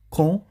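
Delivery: "Com" as one syllable, kõ, silent D; French pronunciation demo. Shown as a demonstration. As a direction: say "Com" loud and high with a nasal French vowel. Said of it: "Vocês vão falar com".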